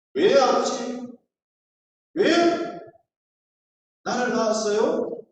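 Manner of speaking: loud, high, demanding why; resentful teen. Retold or imitated. Rebellious teenager confronting his father, loud and resentful. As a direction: hurl the question at him as a bitter teen.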